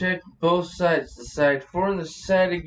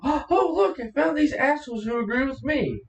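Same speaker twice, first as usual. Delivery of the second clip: high-pitched gloating mimicry; mock someone thrilled to be proven right; higher, gleeful put-on glee; mock triumph; 6 semitones higher than usual. Mocking people hunting confirming sources.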